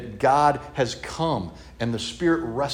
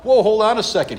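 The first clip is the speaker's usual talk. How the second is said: startled protest; 9 semitones higher, 5 dB louder than usual. Retold or imitated. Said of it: "John's like".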